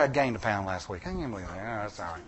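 muttered aside about gaining a pound; under-breath aside. Real, not acted. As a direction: drop away as if talking to yourself. Muttered aside about diet gain.

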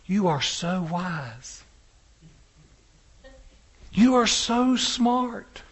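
slow, drawn-out admiring words; mock awe. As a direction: imitate onlookers marvelling, stretching each word. Bystanders' mock admiration in story.

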